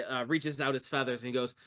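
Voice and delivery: normal talking voice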